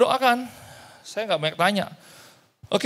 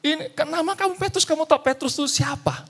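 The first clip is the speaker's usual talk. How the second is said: excited question; 9 semitones higher than usual. Retold or imitated. Pastor excitedly questions Peter.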